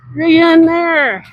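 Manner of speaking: slow, high, stretched-out call; drawn-out call. Real, not acted. Calling out while riding.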